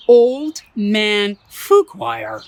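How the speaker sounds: slow, loud, drawn-out name; storyteller announcing the grumpy old man; dramatic name reveal. A narrator's reading. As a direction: boom out the old man's name.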